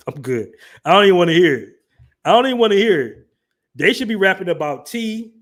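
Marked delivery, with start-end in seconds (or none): 0.8-1.7 louder, flat refusal to listen; loud dismissal. Real, not acted. Brushing off fans of UK rap, loud dismissal. 2.2-3.3 faster, higher repeat of the refusal; faster loud dismissal. Real, not acted. Doubling down to the chat, faster loud dismissal.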